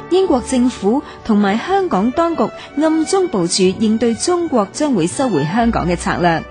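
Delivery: usual speaking voice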